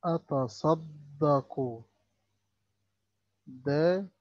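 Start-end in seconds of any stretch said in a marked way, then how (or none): 0.0-1.8 أتصدق said very slowly with the د doubled; shadda demo. Shown as a demonstration. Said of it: "دي شدة وفوقها ضمة". (نطق الشدة ببطء)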